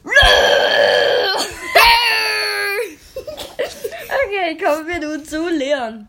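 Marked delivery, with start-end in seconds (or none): 0.2-4.2 real burst of laughter; laughing hard at the clip; loud laughter. Real, not acted. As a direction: let loose a long laugh. Laughing after repeating line.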